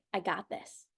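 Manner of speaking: soft, low, self-assured; calmly sure of yourself; quiet confident. Retold or imitated. Voicing the body, calm confident.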